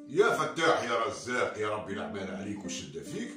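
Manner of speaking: loud hopeful morning prayer; fisherman praying. Acted. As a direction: call on God like the fisherman. (دعاء الصياد بصوت عالٍ)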